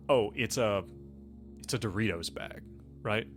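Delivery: ordinary delivery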